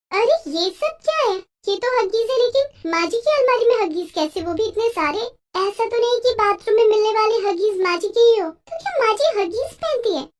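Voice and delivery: put-on a young wife stumbling on a shocking secret; surprised young bahu. Daughter-in-law finds the diapers. (हैरान बहू की ऊँची, चौंकी हुई आवाज़)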